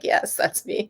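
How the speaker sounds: breathy whisper of a reply; whispering back to him. Retold or imitated. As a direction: whisper it, a bit sheepish. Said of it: "I'm like".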